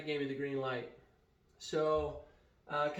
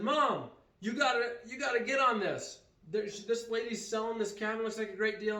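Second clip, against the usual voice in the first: urgent pleading; 6 semitones higher, 4 dB louder than usual. Retold or imitated. Urging mom on the phone.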